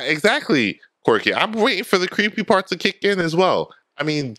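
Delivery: ordinary talk, nothing marked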